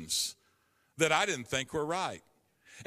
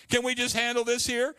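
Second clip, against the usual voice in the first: pleading; 8 semitones higher, 4 dB louder than usual. Retold or imitated. Bargaining with God.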